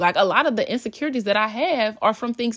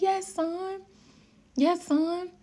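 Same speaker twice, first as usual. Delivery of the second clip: proud mom; 11 semitones higher, much slower than usual. Retold or imitated. Proud mom affirming son.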